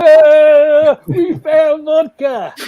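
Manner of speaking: put-on loud, high, drawn-out drunken wail; hammed-up staggering-drunk holler; mock drunk. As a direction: imitate sloshed revellers crowing slowly. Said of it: "they're all staggering around".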